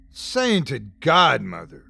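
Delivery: slow stunned exclamation; astonished cry. A narrator's reading. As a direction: draw it out in shock. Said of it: "cried Smith".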